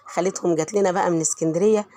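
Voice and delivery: normal talking voice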